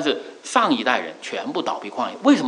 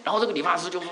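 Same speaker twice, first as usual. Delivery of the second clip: normal talking voice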